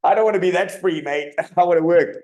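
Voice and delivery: high and loud through laughter; laughing. Retold or imitated. Coach telling host he hated the downtime, laughing loudly. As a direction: laugh out a mock complaint about free time. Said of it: "you're like".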